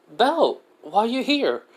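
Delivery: put-on high, surprised young woman's voice; a guild clerk startled to see a friend; surprised guild girl. Edna surprised to see Belle.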